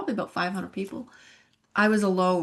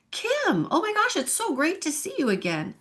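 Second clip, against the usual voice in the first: high, bright-eyed mock greeting; happy churchgoer greeting; 10 semitones higher than usual. Churchgoer welcoming her back by name: high excited greeting.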